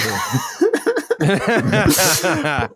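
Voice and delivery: a sudden high burst of laughter; high laughing burst. Real, not acted. Host cracking up at his co-host's joke, high laugh.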